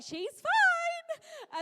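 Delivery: very high, loud, sing-song nurse voice; breezy nurse brushing off a parent's worry; chirpy nurse. Retold or imitated. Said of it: "you're way too chirpy about it".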